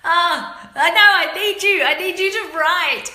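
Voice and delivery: high, loud laughing appeal; giggling for help; laughing plea. Real, not acted. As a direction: beg a friend through laughter.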